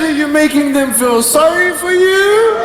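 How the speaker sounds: high, drawn-up suspicious question; mock a mom grilling her son; accusing mother.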